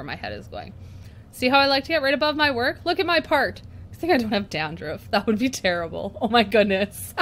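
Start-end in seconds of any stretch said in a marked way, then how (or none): 6.2-7.1 talking through helpless laughter; laughing. Real, not acted. Vlogger to viewers, watching her head in the shot, laughing. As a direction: laugh while groaning at it.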